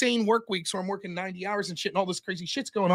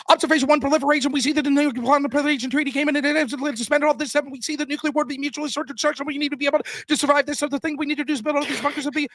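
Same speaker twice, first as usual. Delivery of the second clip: fast, higher-pitched mock speed-reading; rapid-fire debater; 6 semitones higher, faster than usual. Opposing debate team reading its case, imitated as high rapid-fire. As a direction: rattle it off like an auctioneer, pitched up. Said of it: "this team's like".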